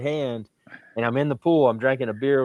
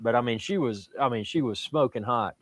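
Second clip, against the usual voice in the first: usual speaking voice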